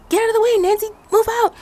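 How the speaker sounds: high, loud chorus of warnings; kids shouting. Retold or imitated. Kids at the top, loudly shouting at Nancy. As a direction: yell at her to clear the way.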